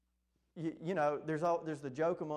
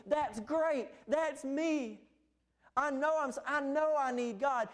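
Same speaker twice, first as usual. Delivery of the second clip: higher, louder gushing new believer; gushing convert; 9 semitones higher, 4 dB louder than usual. Retold or imitated. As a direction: act out an excited convert, high and loud. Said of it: "There is an emotional response".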